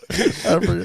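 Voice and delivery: usual speaking voice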